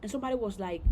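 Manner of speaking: ordinary talk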